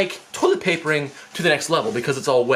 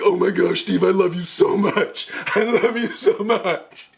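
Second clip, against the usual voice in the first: high, gushing and drawn-out devotion; acted overjoyed gush, breaking into laughter; freaking out; 6 semitones higher, slower than usual. Little brother inwardly thanking Steve for the dare, freaking out. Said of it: "my mind is freaking out".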